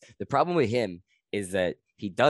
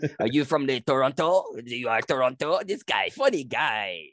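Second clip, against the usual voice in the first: higher-pitched mock French Canadian accent; Quebecois accent; 6 semitones higher than usual. Comedian mimicking Quebecois guys sizing up a Toronto visitor, French Canadian accent. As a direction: tease like a Quebecois barfly in accented English. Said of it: "they, they speak like".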